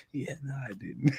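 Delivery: very soft mock admission; sheepish imitation. Imitating the student answering Kayla, soft and sheepish. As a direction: drop right down, imitating an embarrassed answer.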